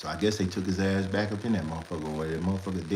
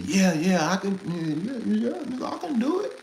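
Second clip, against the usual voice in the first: climbing higher and higher on every yeah; overeager boasting; 12 semitones higher than usual. Retold or imitated. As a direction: oversell it like a drunk who thinks he's the man. Brother boasting on the phone.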